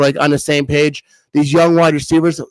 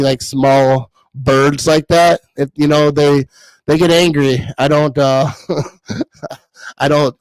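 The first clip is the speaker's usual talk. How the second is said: normal talking voice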